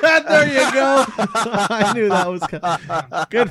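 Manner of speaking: laughing through the words, slow and high. Real, not acted. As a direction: crack up while talking. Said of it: "laughing at the accent joke".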